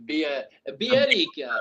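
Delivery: slow, put-on Spanish accent; a clumsy try at a Spanish accent; attempted Spanish accent. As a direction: roll out the name in a mock Spanish accent. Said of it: "What's a Spanish accent?".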